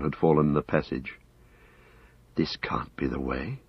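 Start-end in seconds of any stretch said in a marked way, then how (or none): 2.4-3.6 soft, low whisper. A narrator's reading. Frodo at the blocked tunnel, whispering. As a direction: whisper it, much softer and lower. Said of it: "Frodo whispered".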